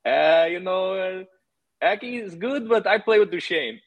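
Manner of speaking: high, hesitant mock Russian accent; Russian accent imitation. Panarin answering the coach in the meeting, high mock Russian accent. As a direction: play a nervous Russian player answering his coach. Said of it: "And Panarin's like".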